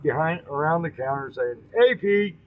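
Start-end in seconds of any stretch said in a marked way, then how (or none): 1.7-2.4 a light called-out greeting from across the diner; friendly yell. A narrator's reading. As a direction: call his name from behind the counter. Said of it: "Yells from beyond".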